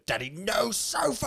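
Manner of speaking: put-on high, slow little-girl whine; imitating his little daughter refusing the sofa; toddler voice. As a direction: squeak it out like a small stubborn toddler.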